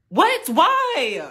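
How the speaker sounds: high, shocked protest. Acted. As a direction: protest in disbelief. Shocked at mom's refusal.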